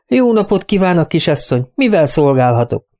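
high, fawning shopkeeper voice; obsequious shopkeeper. Acted. Butcher greeting his best customer, high and fawning.

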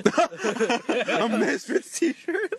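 talking through high-pitched laughter; laughing. Real, not acted. Describing a drawing to friends, laughing. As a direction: squeeze the words out while laughing.

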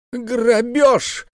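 very high outraged yelp; wailing cry. A narrator's reading. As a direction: cry robbery. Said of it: "завопил Исаак".

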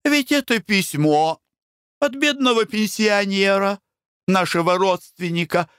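high, anxious explaining; high pleading daughter. A narrator's reading. Blanche anxiously explains the letter to her mother. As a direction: reassure urgently. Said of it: "Blanche explains the letter".

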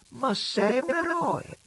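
high, slow, drawn-out flattery; slow mock praise. A narrator's reading. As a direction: linger on eroe, mock-admiring.

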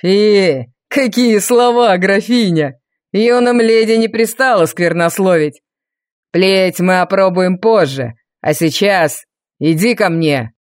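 low, slow demanding villain; menacing villain. A narrator's reading. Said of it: "потребовал Валериан".

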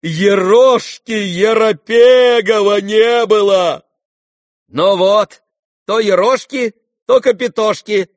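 loud, high, drawn-out indignant cry; heated shouting. A narrator's reading. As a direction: shout it out, worked up and outraged. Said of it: "Генерал кричал в азарте". (крик в азарте)

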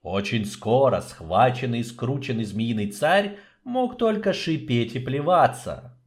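ordinary delivery, nothing marked

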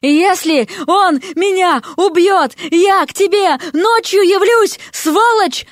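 high, furious yell, word by word; slow, high-pitched enraged bawl. A narrator's reading. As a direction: scream the threat slowly, punching each word. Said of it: "орала лягушка".

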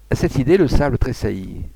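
ordinary delivery, nothing marked

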